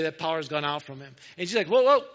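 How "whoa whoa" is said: loud, high mock-startled call; startled halt. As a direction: call out suddenly as if stopping a crowd. Jesus halts the pressing crowd.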